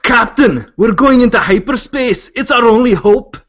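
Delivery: loud, high Scottish captain's cry; Scottish accent. Acted. Player reads the hyperspace sentence to the group, Scottish accent.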